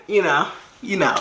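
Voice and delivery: ordinary talk, nothing marked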